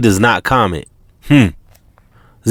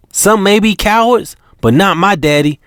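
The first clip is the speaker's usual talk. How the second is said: China's warning; 8 semitones higher than usual. Acted. China warning about Daddy.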